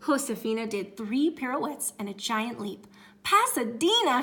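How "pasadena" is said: high, loud thrilled cry; excited cry. Acted. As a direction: cry it out with joy. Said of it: "she cried".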